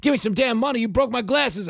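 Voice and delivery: high-pitched, indignant hustler rant; hustler's mock screaming. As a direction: act out a scammer wailing over broken glasses. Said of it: "and starts screaming again".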